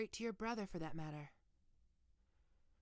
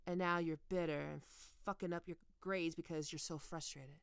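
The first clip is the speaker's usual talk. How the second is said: usual speaking voice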